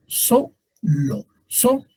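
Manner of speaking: "solo" split slowly, then SO alone, high; SO... lo, then a lifted "So"; stress on SO. Shown as a demonstration. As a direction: isolate the SO of "solo". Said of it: "es la sílaba tónica".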